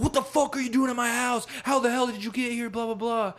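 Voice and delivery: high-pitched imitated panic; retold freak-out. Retelling the man finding her in his house, mock freak-out. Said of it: "freaking out".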